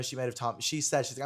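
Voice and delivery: usual speaking voice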